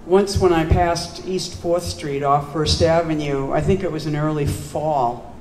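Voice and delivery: slow, measured poem opening; poem reading. Acted. Poet opening a poem called Holes, measured recital. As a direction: recite it deliberately, stressing the first words.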